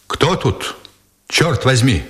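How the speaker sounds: mildly desperate startled call; desperate cry. A narrator's reading. Nikitin finds the sergeant in the room, a restrained desperate cry. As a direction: call out in contained despair. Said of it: "отчаянно крикнул Никитин".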